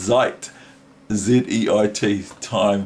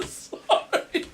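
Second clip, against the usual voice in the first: very high, soft, whispered laugh and sorry; breathy laughing apology; 3 dB quieter, slower than usual. Real, not acted.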